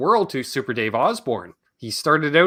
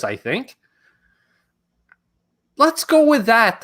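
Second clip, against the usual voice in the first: ordinary delivery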